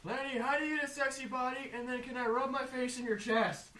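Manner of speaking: high-pitched fan voice; imitated fan question. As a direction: pitch it up like an eager audience member. Acting out a fan's question.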